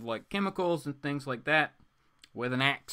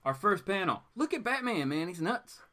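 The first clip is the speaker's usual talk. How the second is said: ordinary delivery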